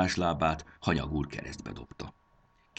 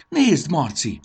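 high, loud call to a companion; excited cry; 11 semitones higher, 10 dB louder, slower than usual. A narrator's reading. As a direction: cry out to get his attention. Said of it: "kiáltott Fanny". (izgatott, magas kiáltás)

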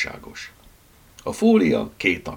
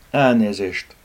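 ordinary delivery, nothing marked

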